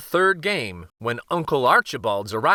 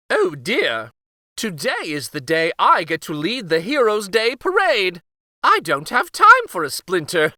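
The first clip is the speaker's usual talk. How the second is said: high, louder panicked outburst; flustered king; 6 semitones higher, 5 dB louder than usual. Acted. King fretting before the parade, loud and flustered. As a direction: fuss and fret, rising to a shout.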